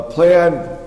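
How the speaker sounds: normal talking voice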